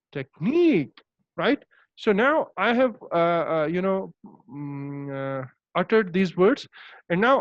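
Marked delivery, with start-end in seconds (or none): 0.1-1.0 "technique" with a short TECH and a long falling NIQUE; stress on NIQUE. Shown as a demonstration. Teacher modelling syllable stress, "technique" stressed on NIQUE. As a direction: stretch NIQUE at the end of "technique". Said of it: "how to stress upon this syllable".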